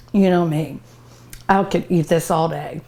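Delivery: usual speaking voice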